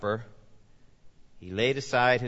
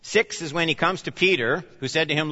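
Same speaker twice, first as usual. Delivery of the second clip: normal talking voice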